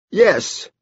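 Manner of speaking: very high-pitched short reply; Edie. A narrator's reading. Girl confirms it to her brother, high voice. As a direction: answer in a high child voice.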